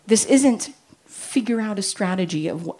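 ordinary delivery

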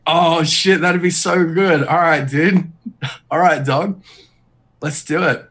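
mock bro Urianger. Urianger agreeing to the prank, hammed-up bro. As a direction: play Urianger as an eager dude. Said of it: "Urianger's like".

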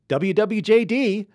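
high, loud, slow W W J D, hammed up; mock exclaimed slogan. Host mocking a churchy slogan, loud and high. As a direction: spell it out big and loud like a bumper sticker.

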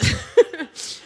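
high, airy real laugh; breathless laugh. Real, not acted. Preacher laughing after the congregation corrects her.

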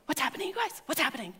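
soft little puzzled voices; confused prank callers. Retold or imitated. Teenagers confused by silence on call, hushed. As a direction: whisper it to your friends, puzzled.

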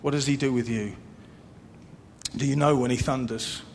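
usual speaking voice

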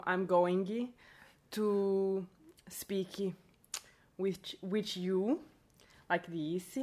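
slow, put-on Brazilian English with softened consonants; halting Brazilian-accented English. Shown as a demonstration. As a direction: demonstrate a Brazilian accent, word by word. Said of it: "I'll put on the accent".

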